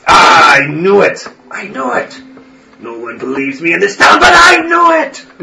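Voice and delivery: put-on vindicated old priest crowing; triumphant old priest.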